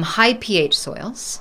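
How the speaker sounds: slow, careful repeat of "high P H soils"; "high P H soils" spaced out slowly, tune rising at the end; nice and slow. Shown as a demonstration. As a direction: draw out "high P H soils" for a confused listener. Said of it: "nice and slowly for me".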